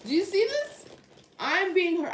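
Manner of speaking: high, loud plea to the viewers; dramatic appeal. Real, not acted. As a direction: beg the audience to look.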